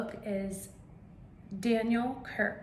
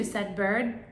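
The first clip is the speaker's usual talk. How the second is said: ordinary delivery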